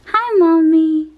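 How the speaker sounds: high, slow pretend baby reply; speaking for baby. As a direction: answer for the baby in his voice. Voicing baby's reply.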